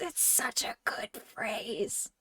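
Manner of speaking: slow, soft breathy whisper. Pretend. Streamer whispers the phrase again to chat. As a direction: whisper it slowly like a secret.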